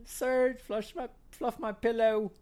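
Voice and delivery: put-on lightly done British accent, weak and whiny; a hint of a whiny bedridden Brit. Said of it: "You gave her a British accent".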